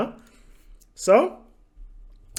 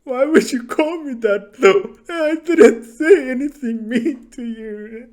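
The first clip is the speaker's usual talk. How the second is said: higher, louder fake whimpering; pretending to be hurt, whining in a fake cry; mock crying; 8 semitones higher, 7 dB louder than usual. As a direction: play the wounded innocent, hammed-up sob. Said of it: "I'm like".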